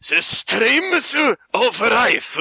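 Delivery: put-on very slow, high Japanese accent; drawn-out samurai movie voice. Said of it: "Just do 'em in the voice".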